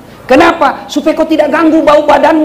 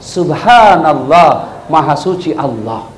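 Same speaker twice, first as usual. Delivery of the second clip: gentle reminder; 11 semitones lower, slower than usual. Shown as a demonstration. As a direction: remind the imam gently and slowly. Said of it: "apa diucapkan".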